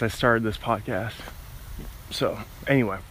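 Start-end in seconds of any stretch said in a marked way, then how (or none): none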